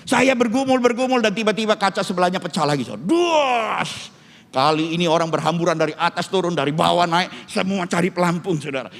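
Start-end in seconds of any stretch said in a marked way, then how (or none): 3.0-4.2 high, drawn-out crash noise; explosive imitation of shattering glass; imitated glass smash. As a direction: burst out with a sudden smash. Imitates second window shattering.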